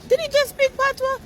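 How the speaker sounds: high, disbelieving question; disbelief. Retold or imitated. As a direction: squeak it out, amazed. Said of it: "I was like".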